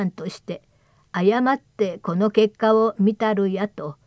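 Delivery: slow, loud formal recitation; formal letter reading. Acted. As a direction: read it like an old official letter.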